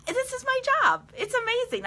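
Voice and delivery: high squeal of disbelief; delighted gush. Real, not acted. Telling viewers she loves her job, high and gushing.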